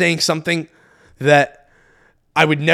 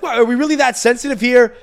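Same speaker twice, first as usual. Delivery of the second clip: higher-pitched, irritated challenge; annoyed; 7 semitones higher, 3 dB louder than usual. Retold or imitated. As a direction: act out protesting everyone's touchiness. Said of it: "I was a little annoyed by that".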